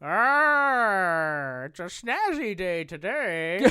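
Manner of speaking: slow monster voice with a drawn-out growl; hammed-up Frankenstein. Host playing a monster with a bolt jar, hammed-up. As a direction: stretch each word like a goofy monster. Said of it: "Do you think that Frankenstein has those".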